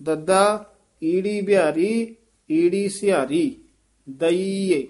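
each letter name separately, then whole ਦਈਏ as "da-ee-e"; spelling out ਦਈਏ. Shown as a demonstration. Teacher showing the spelling, separate pieces then drawn-out ਦਈਏ. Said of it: "ਇਸ ਤਰ੍ਹਾਂ ਬੋਲੇ".

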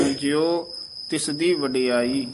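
slow line with a tiny break after "ਜਿਉ"; brief halt on ਜਿਉ. Shown as a demonstration. Teacher showing a short halt, slow careful reading. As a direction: hold "ਜਿਉ", then "ਤਿਸ ਦੀ ਵਡਿਆਈ". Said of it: "ਜਿਉ ਤੇ ਯਮਕ ਲਾ ਦੇਣੀ ਹੈ".

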